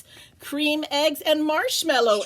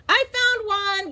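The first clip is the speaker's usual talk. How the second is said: high, loud excited shout; 11 semitones higher, 4 dB louder than usual. Acted. Gilbert shouting from the bushes, excited. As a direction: call out the find from the bushes. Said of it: "Gilbert shouted from the bushes".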